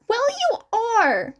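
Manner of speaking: high, sharp retort; snapped. A narrator's reading. Izuku snaps at Shinso before hanging up. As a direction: snap it back, offended. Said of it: "Izuku snapped".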